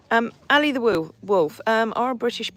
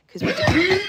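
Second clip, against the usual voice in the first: high, squeaky pretend horse voice; playful pet voice; voicing the horse; 12 semitones higher, 5 dB louder than usual. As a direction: answer for Hoitzer in a silly high voice. Voicing horse's reply to Peg.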